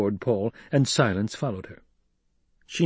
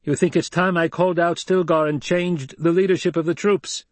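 raised voice; 7 semitones higher than usual. Acted. Paul confronting the crowd about Stilgar, raised voice. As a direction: call it out across the cavern. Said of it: "Again Paul raised his voice".

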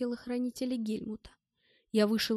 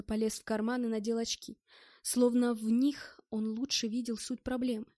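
ordinary delivery

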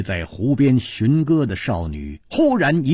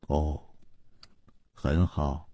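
lower, much softer, slow and weighty; low and heavy; 7 semitones lower, 10 dB quieter, slower than usual. A narrator's reading. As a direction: let each word sink down coldly. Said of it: "沉沉地呃了一声". (低沉缓慢的冷淡语气)

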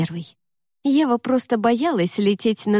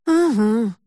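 unsure drawn-out. A narrator's reading. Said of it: "неуверенно протянула Ева".

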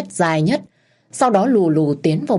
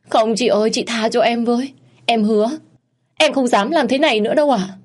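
1 desperate plea; 7 semitones higher than usual. Acted. Girl begs for mercy.

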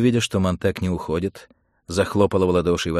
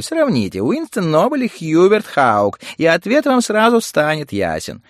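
high, loud, fast gossip; chattering society woman; 8 semitones higher, 6 dB louder than usual. Acted. Women gossiping about the election candidates, chattering excitedly.

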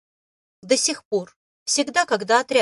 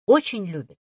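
plain reading voice

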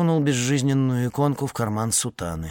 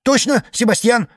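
eager; 12 semitones higher, 7 dB louder than usual. Acted. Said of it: "подхватил кольщик льда".

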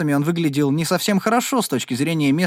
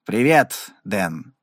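gloomy; 5 semitones lower, much slower than usual. A narrator's reading. Meadows greets Dan before leaving, gloomy. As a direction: greet him gloomily, dropping on the name. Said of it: "мрачно произнёс Медоуз".

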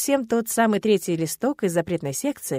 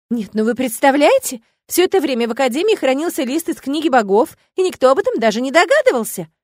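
louder, higher, indignant wonder; breathless gossip about the hidden page; excited exclaim; 6 semitones higher, 9 dB louder than usual. A narrator's reading. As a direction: throw up your hands in amazement. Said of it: "всплеснула руками Матильда".

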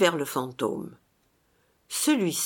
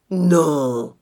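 grudging phantom; 2 semitones lower, 6 dB louder than usual. A narrator's reading. Phantom forced to answer.

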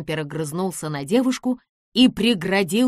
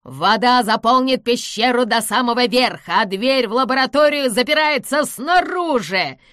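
loud frenzied gloating; wild gloating; 5 semitones higher, 8 dB louder than usual. A narrator's reading. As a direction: crow over their doom. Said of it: "дико расхохотался".

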